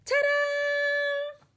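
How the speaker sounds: very high, loud flourish; excited ta-da. Acted. [name] shows off proudly, high and loud. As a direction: announce it like a grand reveal.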